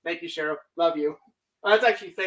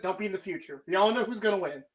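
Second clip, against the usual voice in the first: ordinary talk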